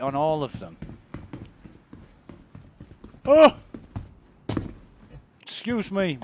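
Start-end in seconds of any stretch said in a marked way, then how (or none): 3.2-6.2 yawning. Real, not acted. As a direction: yawn breaking into the words. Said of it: "I'm fucking yawning".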